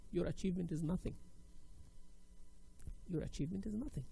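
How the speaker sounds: softly voiced, dismissive interviewer; quiet put-down. Interviewer dismissing a job candidate, quiet put-down.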